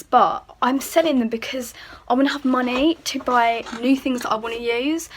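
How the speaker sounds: usual speaking voice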